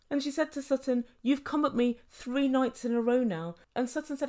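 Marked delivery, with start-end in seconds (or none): none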